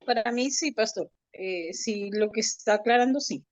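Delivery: normal talking voice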